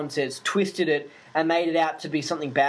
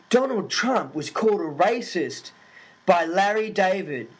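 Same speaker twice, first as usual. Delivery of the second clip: slow, deliberate news-report read; radio newsreader; 3 semitones higher than usual. Retold or imitated. Sydney radio station reporting the joke, in a slow announcer read.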